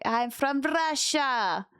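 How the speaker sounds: slow, lightly put-on Russian accent. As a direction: imitate a Russian lady slowly. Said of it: "she's like".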